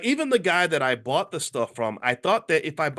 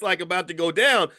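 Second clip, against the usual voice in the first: ordinary talk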